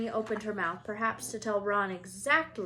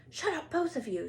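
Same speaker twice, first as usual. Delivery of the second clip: a touch higher, curt command; sharp hush; 7 semitones higher than usual. Acted. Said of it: "said Harry sharply".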